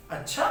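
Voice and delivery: sharp upward question on अच्छा. Shown as a demonstration. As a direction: ask it: अच्छा?. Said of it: "यहाँ प्रश्नवाचक की बात हो गई".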